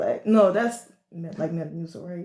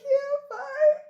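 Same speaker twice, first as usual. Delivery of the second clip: high, small voice in a mock-pitiful retelling; lightly acted, high-pitched feeble reply; weakly fine; 14 semitones higher than usual. Sick kid answering a worried parent, high mock-feeble voice. Acting out their sick younger self.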